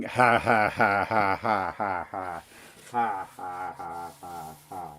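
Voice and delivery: fading laugh. Acted.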